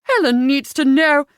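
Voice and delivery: loud, high, firm interruption; exasperated daughter; insistent. A narrator's reading. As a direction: cut in forcefully. Daughter cuts off mother's protest.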